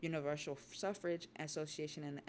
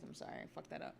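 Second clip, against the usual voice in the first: whispered aside; 6 dB quieter, faster than usual. Real, not acted. Muttered apology mid-reading.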